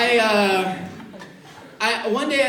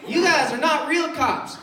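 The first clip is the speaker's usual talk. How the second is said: raised, emphatic put-down; mocking cop; 7 semitones higher, 4 dB louder, slower than usual. Cops making fun of the security guards, mocking. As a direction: jeer at them, pitched up.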